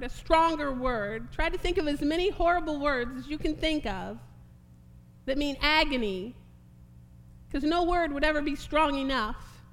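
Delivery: loud, emphatic sermon voice; forceful preaching. Real, not acted. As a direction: press each point hard on the congregation.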